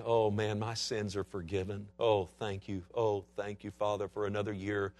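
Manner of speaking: lightly voiced thankful sinner murmuring; mild relieved prayer; relieved worshipper. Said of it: "standing there in front of that priest saying".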